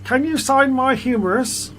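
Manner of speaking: high, slow skeleton fangirl voice; eager squeaky skeleton fan. Acted. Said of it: "I'll do it again".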